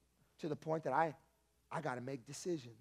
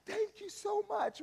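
high, overwhelmed thank-you; grateful roommate; 7 dB louder, slower than usual. Acted. Roommate hugs him after prophecy, high grateful voice. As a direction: gush thanks through tears.

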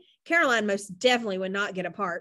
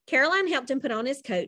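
ordinary delivery, nothing marked

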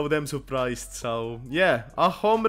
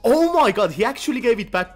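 high, loud and fast; can't believe he got the sword back; thrilled; 7 semitones higher, 7 dB louder than usual. Real, not acted. Sword returned, delight.

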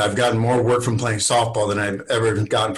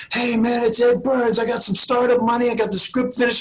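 much higher, breezy buddy voice; Ed Burns on the phone; 11 semitones higher than usual. Retold or imitated. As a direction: imitate a friend calling out of nowhere. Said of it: "he goes".

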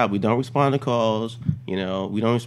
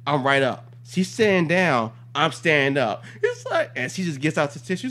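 usual speaking voice

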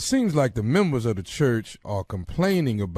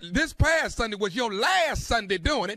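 much higher, stern warning; 10 semitones higher than usual. Acted. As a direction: lay down the law. Caller laying down the law.

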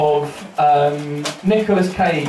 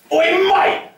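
high-pitched confrontational call; accosting bloke; 11 semitones higher than usual. Retold or imitated. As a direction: challenge him like a lairy pub stranger. Bloke accosts him at the urinals.

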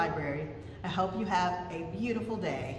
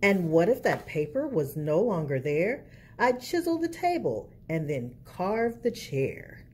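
put-on lilting picture-book narration for kids; reading to children; slower than usual. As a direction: read it like storytime at the library.

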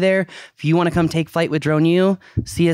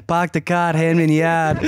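put-on Boston accent. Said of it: "I'll have to do Boston".

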